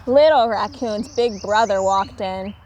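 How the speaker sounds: ordinary delivery, nothing marked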